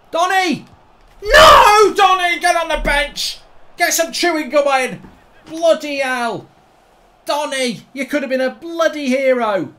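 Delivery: high, loud howl of dismay at the player; anguished shouting. Real, not acted. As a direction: yell in despair at a blown chance. Player misses a big chance.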